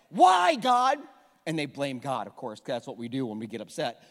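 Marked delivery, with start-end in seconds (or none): 0.1-1.0 crying out to God. Retold or imitated. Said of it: "They're like".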